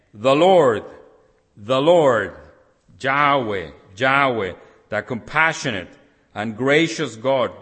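each name rung out loud and falling; loud proclamation. Acted. Preacher reading God's self-proclamation aloud, ringing loud. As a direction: proclaim it to the whole hall.

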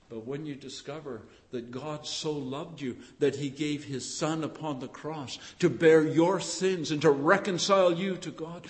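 normal talking voice

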